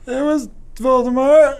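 high, slow put-on old wizard voice; mock Dumbledore. Retelling Dumbledore's reveal to Lucius, high slow mock voice. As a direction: reveal it slowly like a doddering headmaster. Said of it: "He goes".

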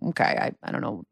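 breathy whispered sarcastic aside; voiceless muttered aside. Real, not acted. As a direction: drop to a whisper, unimpressed.

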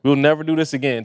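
normal talking voice

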